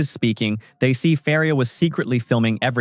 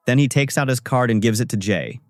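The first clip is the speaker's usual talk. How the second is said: plain reading voice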